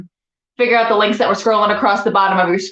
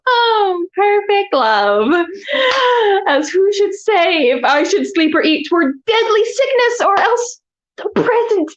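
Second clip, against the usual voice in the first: high, over-the-top theatrical lament; hammy stage actor; 9 semitones higher, slower than usual. Acted. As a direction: ham it up like a tragic heroine. Hammy Shakespeare monologue continues.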